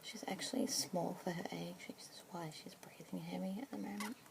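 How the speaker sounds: soft unvoiced whisper throughout; asmr whisper. Keeper introducing a budgie to a listener, whispered. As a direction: whisper gently, close to the mic.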